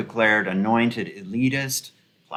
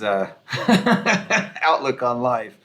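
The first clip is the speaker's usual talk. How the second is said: normal talking voice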